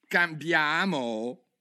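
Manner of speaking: mouse's outraged cry. Acted. The trembling mouse cries back at Alice, drawn out. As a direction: squeal the word in disbelief. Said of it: "gridò il topo".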